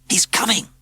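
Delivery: high, excited call; urgent cry. A narrator's reading. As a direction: call the warning out. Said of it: "hissed Maurice".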